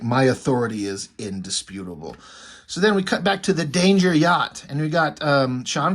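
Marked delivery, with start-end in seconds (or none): 0.0-2.2 deep, commanding villain voice; dark-lord pronouncement, pitched way down; Darth Vader. Acted. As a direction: drop the pitch low for an imperious decree. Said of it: "he's very Darth Vader".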